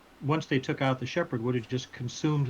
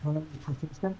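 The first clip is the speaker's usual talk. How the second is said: words muffled behind a hand; 4 dB quieter than usual. Real, not acted. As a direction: speak through your hand, dampened. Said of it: "that was really muffled".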